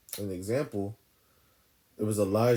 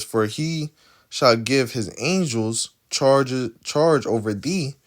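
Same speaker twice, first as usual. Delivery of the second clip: slow scripture reading; 5 dB louder, slower than usual. Acted. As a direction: read the verse slowly and deliberately, word by word.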